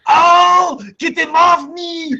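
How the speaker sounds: imitating Arnold yelling in pain, high and loud; hammed-up Austrian panic shout; Arnold screaming. Arnold yelling as the dog bites his leg. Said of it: "Arnold in the background ... he goes".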